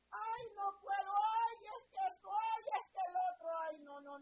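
loud, high, whining mock complaint; hammed-up moaning negative person; imitating a loud griping church member; whiny complainer. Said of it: "una persona que, que llama negatividad".

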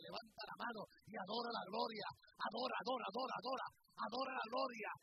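rhythmic, chanting exhortation; worship leading. Acted. Preacher leading the congregation in worship, chanting. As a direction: urge them to adore, building.

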